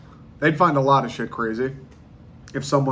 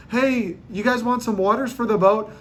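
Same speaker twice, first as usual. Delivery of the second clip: bright, higher friendly host voice; friendly host voice, pitched up; host offering waters; 8 semitones higher than usual. Retold or imitated.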